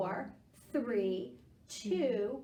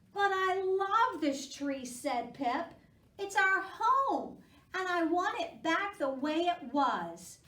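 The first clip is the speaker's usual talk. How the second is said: little squirrel Pip; 6 semitones higher, 7 dB louder, slower than usual. Acted. Pip pleads about his tree.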